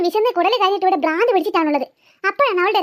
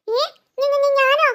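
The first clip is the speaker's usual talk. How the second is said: stammering surprise; 3 semitones higher, much slower than usual. Acted. Mother accused, stammers in surprise.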